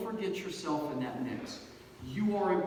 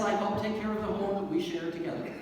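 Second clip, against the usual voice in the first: ordinary talk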